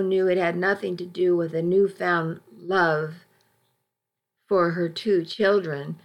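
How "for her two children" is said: plain reading voice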